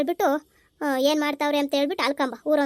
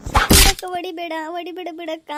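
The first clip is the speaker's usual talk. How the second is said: crying plea; 2 semitones higher, 6 dB louder than usual. Acted. Younger sister being hit by elder sister, crying plea. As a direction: cry out, then whine for mercy. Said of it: "ಒಳ್ಳೆ ಅಳು".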